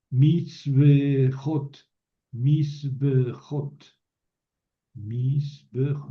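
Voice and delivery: mitzbechot said very slowly, each syllable set apart; slow drilled plural. Shown as a demonstration. Teacher drilling the Hebrew plural mitzbechot, very slow. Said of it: "No es misbejot ... sino misbejot".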